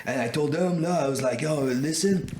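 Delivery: exaggerated Quebecois seller; Quebec accent. Seller blames another guy, in hammed-up Quebec accent. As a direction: play up the Quebec French accent, raising the pitch. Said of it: "good Quebec accent".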